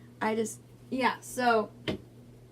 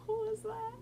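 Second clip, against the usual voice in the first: high squeaky crack, laughing; 10 semitones higher, 8 dB quieter than usual. Real, not acted. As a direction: let the voice break up high. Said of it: "Now my voice is like".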